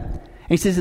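ordinary talk, nothing marked

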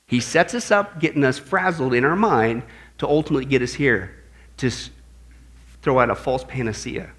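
ordinary delivery

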